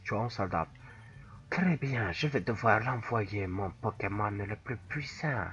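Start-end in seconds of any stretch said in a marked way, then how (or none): 1.5-5.4 low villain monologue; invented opponent voice. Acted. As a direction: play the rival trainer, lower and measured. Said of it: "J'invente les voix au perso".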